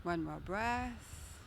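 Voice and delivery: calm, steady guiding voice; guided meditation. Acted. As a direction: guide the breathing gently. Leads a guided breath meditation.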